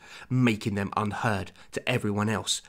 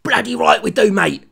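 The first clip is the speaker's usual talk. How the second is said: defiant shout; 9 semitones higher, 9 dB louder than usual. A narrator's reading. Terry's defiant line voiced.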